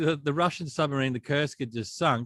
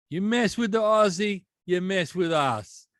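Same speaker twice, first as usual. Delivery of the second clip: bad American sailor accent, higher, slowing on the last line; terrible American accent; 6 semitones higher, slower than usual. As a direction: ham up a bad tough-guy American accent. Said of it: "That's a terrible American accent".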